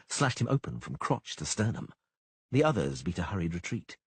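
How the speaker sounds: ordinary delivery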